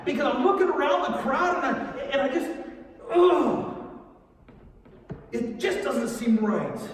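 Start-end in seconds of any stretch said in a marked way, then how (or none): 3.1-3.8 loud, high "ugh"; exasperated groan. Acted. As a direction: burst out in an overwhelmed groan. Overwhelmed by disorientation at the crowd.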